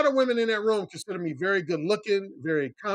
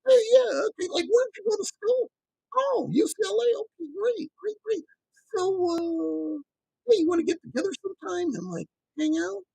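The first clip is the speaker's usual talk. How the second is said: high mocking dude voice; a mocking impression of a boring dude chatting up a woman, voice pitched high; mock generic guy; 12 semitones higher than usual. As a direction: ham up a bland pickup guy, pitched high. Mocking a typical guy's pickup chat.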